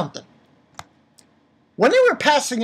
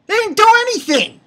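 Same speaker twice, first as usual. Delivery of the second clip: much higher, loud outburst; exasperated shout; 8 dB louder than usual. Real, not acted. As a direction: yell in real frustration. Ranting at manga characters.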